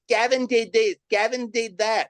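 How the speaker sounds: high, singled-out mock accusation; tattling cousins. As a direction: imitate kids blaming their cousin. Imitating cousins blaming him.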